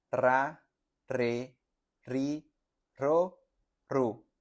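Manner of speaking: "Ra, re, ri, ro, ru" with a trilled R; teacher's trill drill; R syllable drill. Shown as a demonstration. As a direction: roll each R syllable. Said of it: "tu lengua haga esa pequeña vibración".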